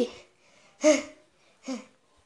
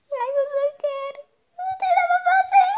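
high whiny complaining. Acted. Bored kid whining in car.

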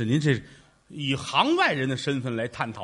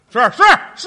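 laughing agreement; 11 semitones higher, 9 dB louder, faster than usual. Real, not acted.

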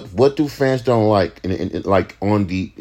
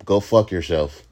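usual speaking voice

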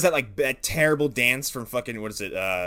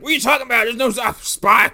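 high, loud panicked denial; panicked Tex; 8 semitones higher, 8 dB louder than usual. Acted. Tex yelling at the man he grabbed. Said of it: "He's like".

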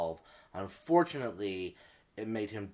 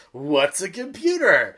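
put-on little girl, laughing; 6 semitones higher, 7 dB louder, slower than usual. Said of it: "And the little girl goes".

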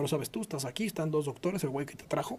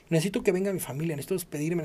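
ordinary delivery

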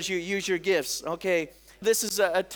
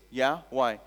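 wary husband; 9 semitones lower than usual. Retold or imitated. Wary husband answers little girl.

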